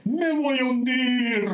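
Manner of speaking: slow, high, stretched-out wail; hammed-up drowning lament; acted panicked wail. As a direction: cry out as if going under. Pierce wailing as he sinks.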